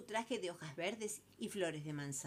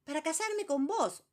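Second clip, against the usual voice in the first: much higher, louder eager voice; eager proposal; 11 semitones higher, 8 dB louder than usual. Acted. Number three eagerly declaring he'll marry her. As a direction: say it bursting with pride.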